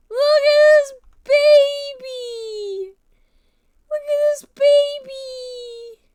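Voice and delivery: very high, loud, slow squeal of delight; baby-talk squeal. Pretend. Showing her favorite puppy picture, loud high slow squeal. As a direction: stretch it out like cooing at a newborn.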